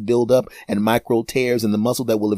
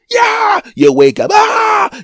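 pain yell; 8 dB louder, much slower than usual. Acted. Waking in cramp pain.

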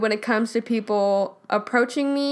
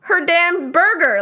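higher, louder exasperated quote; mock exasperated retort; 5 semitones higher, 6 dB louder, slower than usual. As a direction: throw the line back, fed up. Retells snapping at the woman.

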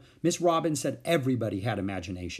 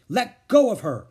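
louder, higher angry order; 9 semitones higher, 9 dB louder than usual. A narrator's reading. Beezus angrily telling Wayne to let go. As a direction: protect your sister, firmly. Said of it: "said Beezus angrily".

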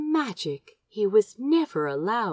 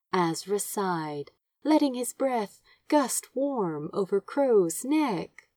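ordinary delivery, nothing marked

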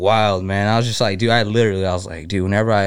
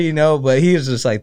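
usual speaking voice

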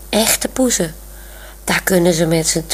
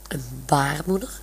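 "baarmoeder" murmured much softer as a puzzled question, rising at the end; puzzled thought; 3 semitones lower, 8 dB quieter than usual. A narrator's reading. Olaf wonders about Monique's strange word, soft puzzled "baarmoeder". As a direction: murmur "baarmoeder" to yourself, baffled. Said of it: "denkt Olaf".

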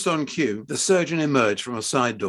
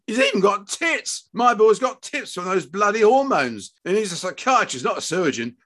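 high-pitched, scandalised father; outraged rant; 9 semitones higher, 4 dB louder than usual. A narrator's reading. Husband ranting to wife about the hormones, outraged. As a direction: rant in appalled disbelief.

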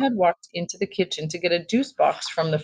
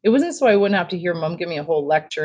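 plain reading voice

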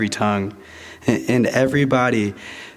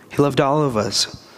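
normal talking voice

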